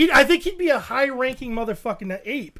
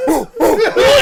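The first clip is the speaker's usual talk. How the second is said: higher, louder excited hooting; chimp hoots; 9 semitones higher, 9 dB louder than usual. Retold or imitated. Chimps fleeing the alpha, higher, louder hooting. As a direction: hoot like panicking chimps. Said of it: "they're all like".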